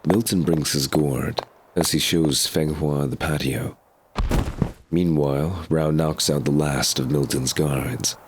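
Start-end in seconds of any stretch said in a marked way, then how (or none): none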